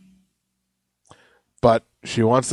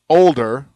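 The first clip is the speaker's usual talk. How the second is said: put-on "older" pushed louder, short of a yell; restrained capital-letter emphasis; 8 dB louder than usual. Shown as a demonstration. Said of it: "I'm not gonna yell at you".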